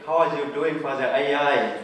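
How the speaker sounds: ordinary talk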